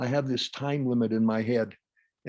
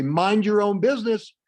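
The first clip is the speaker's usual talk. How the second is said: loud, higher rebuff; angry retort; 6 semitones higher, 6 dB louder than usual. Acted. Upset person rebuffing a concerned remark, angry and loud. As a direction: tell them off sharply. Acted hostile retort to crossing.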